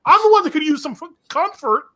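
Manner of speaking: high, whiny mock self-pity. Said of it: "I was like".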